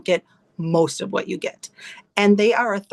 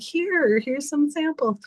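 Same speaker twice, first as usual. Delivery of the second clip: higher, lightly put-on sample offer; cheery offer; 6 semitones higher, slower than usual. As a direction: hand over a sample in a bright pitched-up voice. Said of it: "I'm like".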